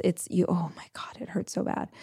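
whispered, pained aside; whispering how much it hurt; hushed aside. Real, not acted. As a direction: breathe it out like it still hurts. Describing painful jaw massage.

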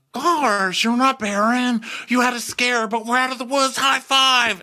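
very high, loud peppy voice; chipper Shep. Retold or imitated. Shep consoling Taylor about her scare, very high peppy. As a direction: cheer her up like a coach. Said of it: "And he's like".

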